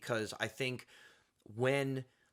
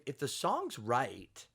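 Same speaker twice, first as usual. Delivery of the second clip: ordinary talk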